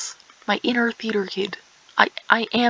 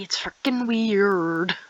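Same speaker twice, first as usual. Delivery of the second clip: ordinary talk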